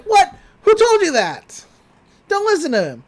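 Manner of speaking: high, loud startled protest; alarmed. Retold or imitated. Bill panicking as Dipper tells the others, loud and high. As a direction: blurt it out, caught off guard.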